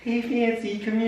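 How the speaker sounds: put-on high, soft baby talk to a cat. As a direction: coo it like talking to a pet. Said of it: "a little bit like a baby voice".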